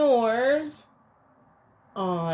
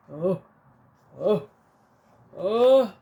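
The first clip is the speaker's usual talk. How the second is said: building up to a sneeze; 2 semitones lower, 6 dB louder than usual. Acted. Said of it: "makes the bear go".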